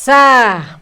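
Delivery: ordinary delivery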